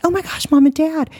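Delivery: high-pitched gushing surprise; imitating a thrilled squeal; mock excitement. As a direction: act out an over-excited reunion. Mock reaction she says she didn't have.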